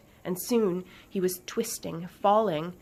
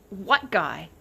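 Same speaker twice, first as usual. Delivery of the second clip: puzzled, slightly louder question; confused question; 5 dB louder than usual. Acted. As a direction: ask it in bafflement.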